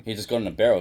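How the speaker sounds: ordinary talk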